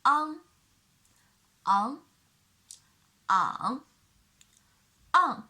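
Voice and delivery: "on" in each tone; four tones demo. Shown as a demonstration. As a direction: run "on" through the tones. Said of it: "a with the velar nasal".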